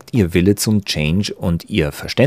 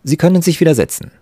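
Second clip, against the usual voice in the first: high, fast call across the room; 10 semitones higher, 4 dB louder, faster than usual. A narrator's reading. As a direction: call out to the student at the door. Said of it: "rief ihm der Professor zu". (laut und schnell hinüberrufen)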